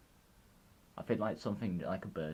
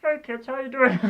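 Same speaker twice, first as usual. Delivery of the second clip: high, loud puppet voice; jokey high-pitched frog puppet impression; Kermit; 10 dB louder than usual. Shown as a demonstration. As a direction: pitch it way up and call out to the kids. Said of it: "I just went into Kermit".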